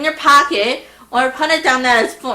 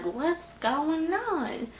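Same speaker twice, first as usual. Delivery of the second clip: quiet confusion; 4 semitones higher, 14 dB quieter, much slower than usual. Retold or imitated. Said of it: "I was like".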